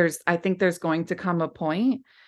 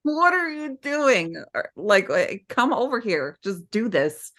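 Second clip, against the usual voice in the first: mock urging; 3 semitones higher than usual. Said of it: "I'm a little like".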